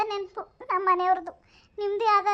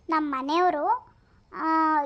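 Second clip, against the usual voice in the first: ordinary talk, nothing marked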